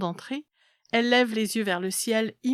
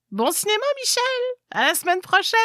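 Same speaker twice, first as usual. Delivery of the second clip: bright high farewell call; cheerful goodbye call; 12 semitones higher, 4 dB louder, faster than usual. Acted. Grandmother calling goodbye cheerfully.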